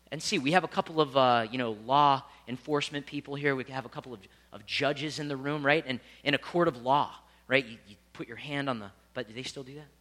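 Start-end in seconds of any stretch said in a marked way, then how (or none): none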